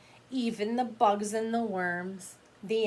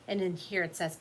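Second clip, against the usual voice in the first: plain reading voice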